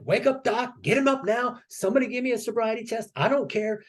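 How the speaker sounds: high, urgent mock outburst; flipping out, retold. Sailor demanding a sobriety test to prove himself, retold high-pitched flip-out. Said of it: "I flipped out".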